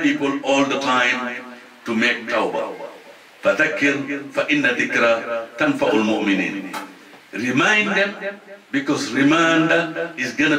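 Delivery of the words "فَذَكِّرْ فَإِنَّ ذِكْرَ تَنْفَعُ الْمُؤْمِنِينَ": rapid chanted Arabic verse; تلاوة سريعة; Quranic recitation. Acted. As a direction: recite the verse quickly in one breath.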